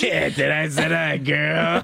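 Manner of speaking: laughing troll voice. Shown as a demonstration. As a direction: croak it like Gollum. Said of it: "Okay, Gollum".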